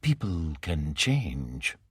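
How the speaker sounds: low, slow, soft and mild; calm him with quiet mildness; said mildly. A narrator's reading. Morrow gently countering the professor, said mildly. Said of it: "Morrow said mildly".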